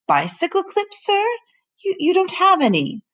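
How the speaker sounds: high fussy housekeeper voice. Acted. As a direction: play the flustered housekeeper. Housekeeper answers the professor.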